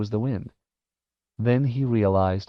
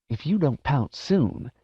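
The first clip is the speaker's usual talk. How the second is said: slow, higher urgent hiss; sinister goading hiss; 4 semitones higher than usual. Acted. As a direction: hiss it as a sly warning. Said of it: "Jive hissed".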